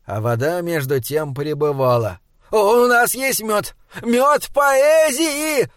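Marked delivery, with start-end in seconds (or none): 2.5-5.7 gurgling through water. Acted. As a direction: talk with water in the mouth. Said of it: "пробулькал Галлар, которому вода уже заливалась в рот".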